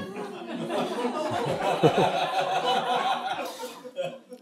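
breathy, high burst of real laughter; high airy laugh. Real, not acted. Laughs at the epitaph.

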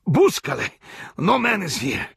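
very high, choked no; hanged man gasping denials; gasped denial. A narrator's reading. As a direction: beg through a crushed throat. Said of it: "gasped the hangman".

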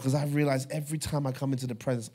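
ordinary talk

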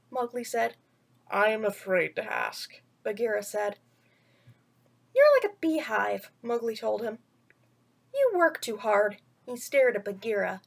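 put-on gentle storyteller voice reading to children; bedtime story reading. A narrator's reading. Vlogger reading a picture book to kids, storytelling voice.

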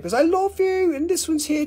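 jumps way up on "love you"; high sing-song. Real, not acted. Seller cooing love to viewers, high sing-song. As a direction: coo it high and sweet. Tells viewers she loves them.

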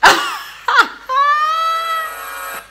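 a high squealing burst of laughter; shrieking laugh. Real, not acted. Fan cracks up after the clip's joke. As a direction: laugh helplessly. After "your turn [name].

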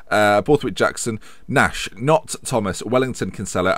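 usual speaking voice

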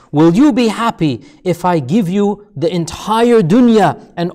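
swelling rhetorical questions; building emphatic questioning. Retold or imitated. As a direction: start measured, build to loud, high, ringing questions. Acting out Allah's questioning of man.

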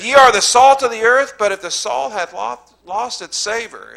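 high, loud, ringing pulpit preaching; preaching at full force. Acted. Pastor warning the congregation against lying, preached high and loud. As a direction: lift it up and preach it hard. Quoting scripture while preaching.

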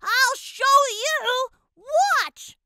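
loud, slow angry warning; steamed. A narrator's reading. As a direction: show them who's boss. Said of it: "growing steamed".

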